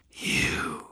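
unvoiced vampire hiss. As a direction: hiss the word like a predator. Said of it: "the vampire hissed".

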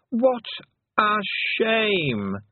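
high, drawn-out regal taunt; the Queen, mock pity. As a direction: savour each word with false sympathy.

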